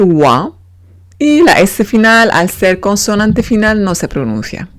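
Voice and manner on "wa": wa said low and short, like Spanish gua without g; French oi as wa; the sound wa. Shown as a demonstration. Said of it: "que se va a leer".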